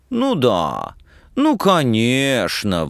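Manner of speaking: drawn-out, affected sneer; scornful mimicry. A narrator's reading. Student objecting to the woman's warning, mockingly affected. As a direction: mince the words with slow contempt. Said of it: "презрительно кривляясь".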